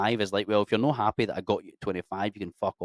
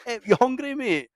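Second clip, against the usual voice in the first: high-pitched hollered welcome, half laughing; mock eager invitation; 13 semitones higher, 4 dB louder than usual. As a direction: usher a stranger in with hammed-up hospitality. Imagined Glasgow strangers inviting him in.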